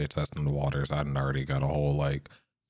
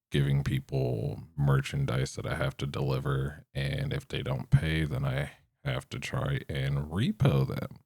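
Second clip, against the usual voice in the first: ordinary talk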